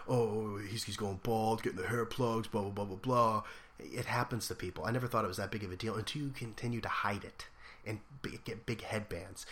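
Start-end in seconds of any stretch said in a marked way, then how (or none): 0.1-3.4 mock the bald jokes. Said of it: "such a corny joke that everyone does".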